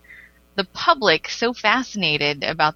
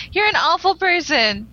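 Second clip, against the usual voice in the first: mock scolding; 10 semitones higher, 3 dB louder than usual. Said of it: "Be like".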